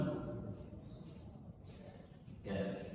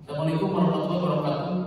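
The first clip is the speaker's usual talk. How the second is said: fast rattled-off greeting; rushed announcement salam; faster than usual. Shown as a demonstration. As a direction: hurry through to the notice. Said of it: "cepat lupa pengumuman". (salam cepat untuk pengumuman)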